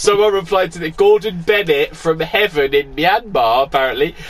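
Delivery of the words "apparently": normal talking voice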